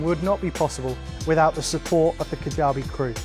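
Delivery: ordinary delivery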